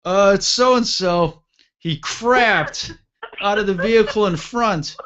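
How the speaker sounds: high, strained report; retold panic. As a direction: imitate a panicked commander on the radio. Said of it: "if you could hear the panic in the T C's voice".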